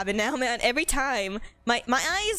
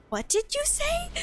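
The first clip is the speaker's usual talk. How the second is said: high, slow disbelieving "what did you say"; sister's stunned question. Retold or imitated. Sister reacting to a burn, high and stunned. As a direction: mimic a sister caught off guard by a burn. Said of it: "my sister was like".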